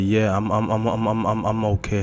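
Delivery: stammering reassurance; rapid stuck repeats before getting it out; nervous stutter. Acted. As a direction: trip repeatedly over the first words. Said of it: "as he stuttered on his words".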